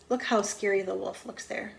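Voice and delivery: ordinary delivery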